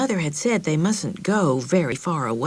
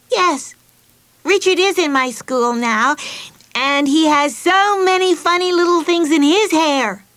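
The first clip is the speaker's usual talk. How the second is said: put-on high, bright child voice, pleased with herself; beaming little girl; 10 semitones higher, 5 dB louder than usual. Betsy tells the family about Richard, beaming child. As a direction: grin through every word.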